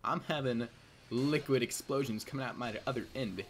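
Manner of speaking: ordinary delivery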